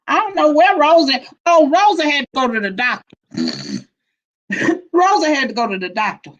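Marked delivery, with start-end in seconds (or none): none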